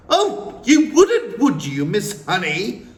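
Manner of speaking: high, loud mocking sneer; mock her in a shrill sneer; mocking Trunchbull. A narrator's reading. Trunchbull mocking Miss Honey's objection, sneering loudly. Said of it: "the Trunchbull said".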